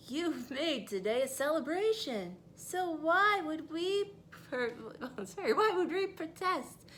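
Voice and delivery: beaming at Ruby; cheerful townsfolk. Acted.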